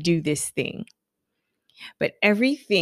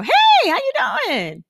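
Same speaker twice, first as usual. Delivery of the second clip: greeting pitched way up, ringing and louder; high sing-song hello; 4 dB louder than usual. Shown as a demonstration. Showing how she greets clients, high and sing-song. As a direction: sing it out like a hostess.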